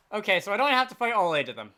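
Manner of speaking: normal talking voice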